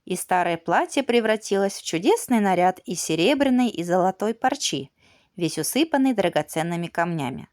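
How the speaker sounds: ordinary delivery, nothing marked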